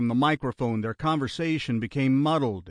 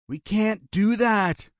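slow, high-pitched plea; begging; 9 semitones higher, 4 dB louder, slower than usual. A narrator's reading. Brother begging the gunman to hold off, desperate plea. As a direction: plead desperately in a raised voice. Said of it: "Barry begged".

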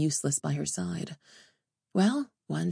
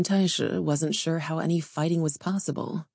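usual speaking voice

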